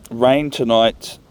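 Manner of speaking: normal talking voice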